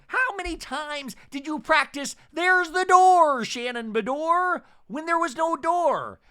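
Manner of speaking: mock her with a high squeal; hammed-up high Shannon impression. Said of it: "And Shannon going".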